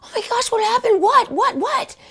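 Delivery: retold frantic excitement, far up in pitch; going crazy. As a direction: blurt it out in wild surprise. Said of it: "I start going crazy".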